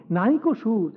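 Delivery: plain reading voice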